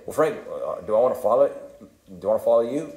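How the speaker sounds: usual speaking voice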